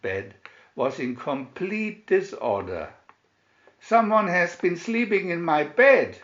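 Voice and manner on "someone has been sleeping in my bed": higher and louder indignant cry; Mama Bear dismayed at her messy bed. A narrator's reading. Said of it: "cried Mama Bear".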